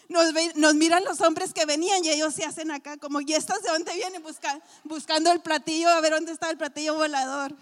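loud, high, laughing while telling. Real, not acted. Telling the sisters about the men, laughing.

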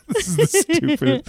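laughing through the words; giggling as he says it. Real, not acted. Laughing at their own bit.

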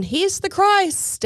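high, drawn-out mock announcement; stretched, high-pitched exclamation; excited proclamation. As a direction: act out someone blurting out big news. Imagined person announcing it excitedly.

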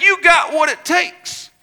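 high, drawn-out echo; imitated echo call. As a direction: send it back slowly like an echo. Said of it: "the voice came back".

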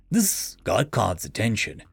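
ordinary delivery, nothing marked

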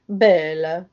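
a bad, hammed-up Italian accent on "Bella"; horrible Italian accent. Shown as a demonstration. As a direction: roll out "Bella" the Italian way, badly. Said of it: "say it with an Italian accent".

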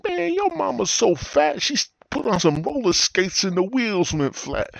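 put-on sing-song a yo-mama insult like a kid; school bus roast.

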